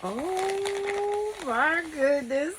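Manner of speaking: long, high, drawn-out wonder; overwhelmed by the gift, slow and high; emotional gasp. Real, not acted. Unboxing a gift from a friend, overwhelmed delight. Opening happy mail gift.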